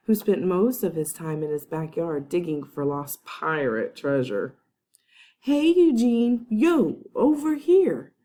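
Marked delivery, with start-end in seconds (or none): 5.4-8.1 loud, high call across the cafeteria; boy calling a friend over, loud and high. A narrator's reading. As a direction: shout to a friend across the room. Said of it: "called out".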